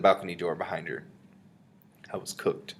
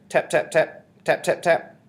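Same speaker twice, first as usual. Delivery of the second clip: high, loud, percussive imitation of knocks; spoken knocking effect; loud tapping; 9 semitones higher, 6 dB louder, slower than usual. A narrator's reading. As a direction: sound out each knock sharply. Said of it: "The bottle made quite a loud tap".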